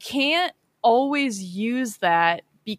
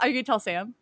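usual speaking voice

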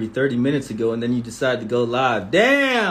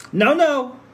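high, sharp no no; mock ringtone shout; 10 semitones higher, 3 dB louder than usual. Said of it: "I have your voice as a ringtone".

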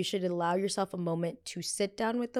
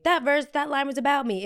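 raised, indignant mock quote; 8 semitones higher, 6 dB louder than usual. Vlogger mocking [name]'s claim, raised and indignant. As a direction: play her up as outraged. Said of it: "[name] took it upon herself to say".

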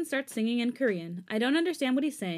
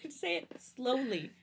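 drawn-out, slow and higher; slow retold plea; 4 semitones higher, 4 dB quieter, slower than usual. Telling boyfriend to slow his Korean down, slowly. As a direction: stretch out each word. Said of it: "he says it so fast".

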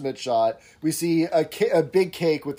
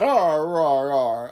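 pirate arr; much slower than usual. Retold or imitated. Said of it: "he started making that".

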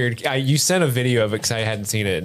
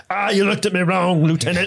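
high-pitched mock orc outburst; touchy orc; 7 semitones higher than usual. Orc picking on his lieutenant, mock aggrieved. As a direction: squawk it as an offended orc. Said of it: "orcs are just like".

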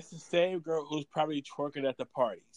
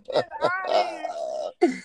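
high, slow exclamation; long, wailing brush-off; drawn-out dismissal; 10 semitones higher, 3 dB louder, much slower than usual. Real, not acted. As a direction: stretch it out high in playful disbelief. Mock dismissal of the others.

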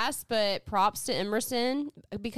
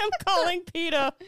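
high-pitched, through giggles; laughing mock threat; 9 semitones higher, 4 dB louder, faster than usual. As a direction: threaten while cracking up.

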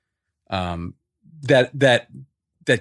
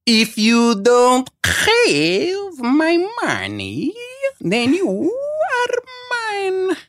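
much higher, very slow, louder Russian villain drawl ending in a cry; Malkovich's Russian accent again; 3 dB louder, much slower than usual. Retold or imitated. Imitating Malkovich's second take with the Russian accent. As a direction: overplay the threat, stretch it out. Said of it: "Take two".

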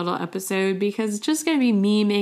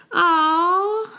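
a high, louder aw for something cute; sweet aw; 10 semitones higher, 5 dB louder than usual. Shown as a demonstration. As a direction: coo aw at something adorable. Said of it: "is how sweet".

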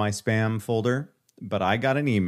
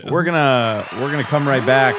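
loud, higher TV host voice; 5 semitones higher, 7 dB louder than usual. Pretend. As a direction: announce it like a talk show host. Mock TV host going to commercial.

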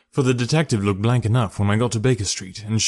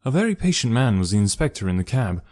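ordinary delivery, nothing marked

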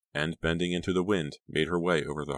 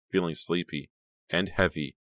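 usual speaking voice

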